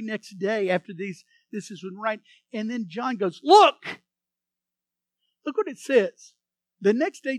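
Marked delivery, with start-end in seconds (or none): 3.4-4.0 sharp, loud, higher exclamation; calling out. Retold or imitated. John calling to the crowd as Jesus approaches, loud. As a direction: call out to make them look.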